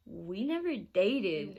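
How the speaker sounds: drawn-out, incredulous protest; acted-out indignant denial; baffled denial. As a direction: stretch it out slowly in disbelief.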